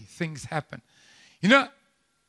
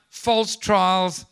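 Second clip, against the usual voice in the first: ordinary talk, nothing marked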